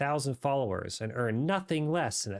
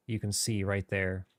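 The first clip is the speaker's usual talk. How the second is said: ordinary talk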